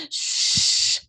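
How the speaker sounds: whispered shh to calm a crying friend; hushing whisper. Acted. Louise hushing weeping Cook, whispered.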